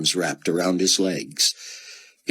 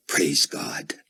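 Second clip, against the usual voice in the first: whispered thought; 3 semitones lower than usual. A narrator's reading. Buck thanks God inwardly in a hushed whisper. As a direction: breathe it as an inner thank-you. Silent prayer in Buck's head.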